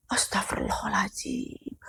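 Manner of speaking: breathy whispered astagfirullah; whispered prayer. Real, not acted. Teller recalling the ghost, frightened whispered prayer.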